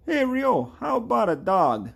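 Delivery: very high, loud hot dog seller; Tom. Acted. Hot dog seller hailing the player, high loud put-on voice. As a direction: call out in a high cartoon voice. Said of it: "Tom. We need Tom".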